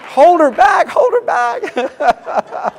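laughing call to hold her back; high, loud mock alarm, laughing; playful shout. Real, not acted. Pastor calls out to restrain wife.

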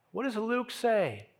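ordinary talk, nothing marked

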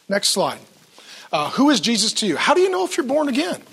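normal talking voice

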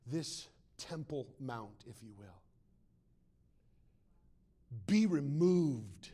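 quietly pointed scripture reading; measured reading. Shown as a demonstration. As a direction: space out each phrase with weight. Preacher rereads the verse deliberately.

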